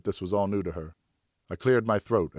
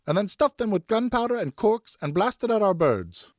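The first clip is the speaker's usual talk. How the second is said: much higher and louder, indignant; furious mother venting; angry wife; 14 semitones higher, 4 dB louder than usual. Acted. Wife raging to her husband about the gunpowder, much higher and louder.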